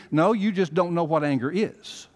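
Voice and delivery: high, loud pulpit preaching, building; rising Southern pulpit fervor; full-force preaching. Acted. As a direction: preach it hard, lifting the congregation. Pastor preaching on anger.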